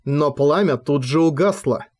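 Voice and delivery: usual speaking voice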